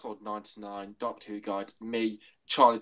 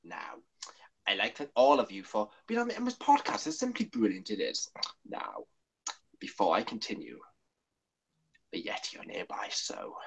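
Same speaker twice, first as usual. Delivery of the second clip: hushed, halting Doctor with sudden lifts; softly muttered, stop-start character voice; Troughton impression; 4 semitones lower, 4 dB quieter than usual. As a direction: play a quiet, urgent Doctor. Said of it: "my Charlton impression".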